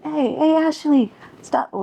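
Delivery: high calling voice, hey Ashley. Retold or imitated. Girl calling after Ashley to stop, high call.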